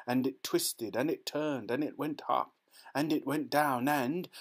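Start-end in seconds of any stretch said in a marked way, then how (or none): none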